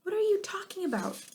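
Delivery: high shouted question; hollered back. A narrator's reading. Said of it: "he hollered back".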